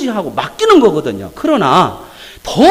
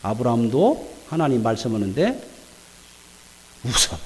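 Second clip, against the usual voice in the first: normal talking voice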